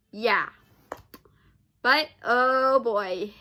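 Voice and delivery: ordinary talk, nothing marked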